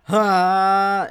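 loud sharp Ha as he powers up; powering-up shout. Retold or imitated. Demon rival powering up for the fight, loud battle shout. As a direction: bark out a battle cry.

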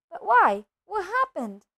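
high-pitched, urgent questioning. A narrator's reading. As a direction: press anxiously for an answer. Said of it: "Harry asked urgently".

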